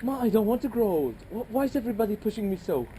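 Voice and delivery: low, fast, pleading with rising force; panicky son. Acted. Son begging his mother before the wedding, panicked. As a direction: push back hard against growing up.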